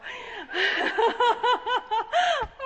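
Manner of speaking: long, high burst of laughter. Real, not acted. She recalls the sunset walk with Jesus, high laugh. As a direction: let out a loud, high-pitched laugh.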